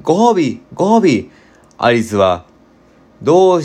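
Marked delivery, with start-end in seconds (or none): 0.0-1.3 lightly voiced crowd calling out, higher; a hint of excited animals crying for a prize; mild eager chorus; crowd clamouring. A narrator's reading. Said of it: "口々にわめくんだ".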